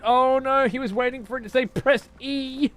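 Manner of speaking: high, whiny imitation of fussy viewers; mocking viewers. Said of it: "I bet it hurt some of you to watch".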